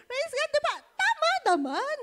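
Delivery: high, loud emphatic appeal; excited. Real, not acted. Preacher rousing the congregation, high and loud. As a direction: push the crowd to agree.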